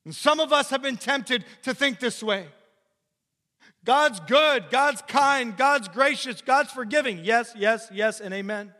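loud, high preacher's build; full-force preaching. Acted. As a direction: preach it out to the congregation.

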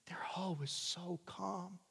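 slow, hushed admiring whisper; whispered imitation of an admirer. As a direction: breathe it in awe. Imitating admirers of calm Christians.

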